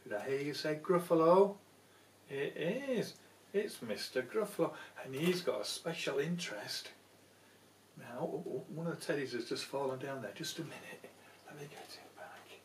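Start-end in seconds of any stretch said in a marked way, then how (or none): none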